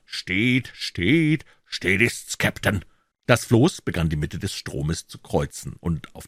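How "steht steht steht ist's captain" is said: barked drill commands. A narrator's reading. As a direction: sing out the helm report. Crew calls back helm report. (gebellte Seemannsrufe)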